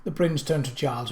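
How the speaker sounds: plain reading voice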